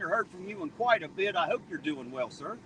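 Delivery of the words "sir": normal talking voice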